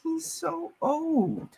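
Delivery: slow, high and incredulous; drawn-out disbelief. Real, not acted. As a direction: stretch it out in disbelief. Disbelief at an old player.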